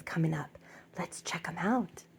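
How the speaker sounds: hushed, conspiratorial whisper; soft whispered invite. Real, not acted. Inviting viewers to see dolls.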